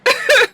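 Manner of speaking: high laugh trailing from a squeal; real amused laughter. Real, not acted. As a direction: keep cracking up, high. Cracks up at her own line.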